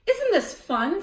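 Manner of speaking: put-on high, loud gleeful kid. A narrator's reading. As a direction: crow it with delight. Wendell gloating to Sophie.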